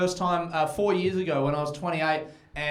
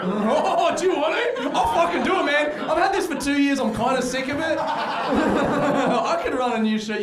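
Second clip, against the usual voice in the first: much higher and louder, laughing through it; giddy at the shirt offer; high laughing glee at an audience member; laughing excitement; 9 semitones higher, 4 dB louder than usual. Real, not acted. Excited over swapping shirts, laughing.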